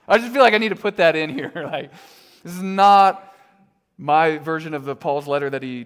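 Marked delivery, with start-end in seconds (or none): 0.1-1.9 louder, quick, laughing it off. Real, not acted. Pastor laughing at his own joke to the congregation, louder. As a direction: giggle through the line.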